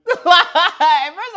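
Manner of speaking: high, loud burst of laughter; laughing. Real, not acted. Vlogger laughing at Rachel's corny lines, laughing. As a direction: crack up at the memory.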